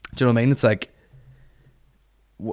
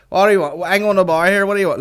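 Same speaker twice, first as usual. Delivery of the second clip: higher, louder, shouting a bar order over the show; pitched-up, noisy audience chatter; imitating loud punter; 9 semitones higher, 5 dB louder, faster than usual. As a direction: mimic an oblivious punter taking orders out loud.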